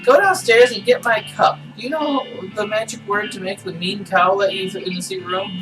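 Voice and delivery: high doll voice; sweet, creepy toy doll; talking doll. Acted. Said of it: "Pull the string".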